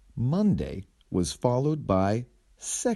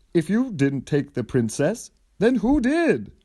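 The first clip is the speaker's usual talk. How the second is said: rising to a high, loud squeak; baffled hero, pitch shooting up; confused hero's high, loud question; hero asking; 9 semitones higher, 4 dB louder than usual. Acted. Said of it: "asked the hero".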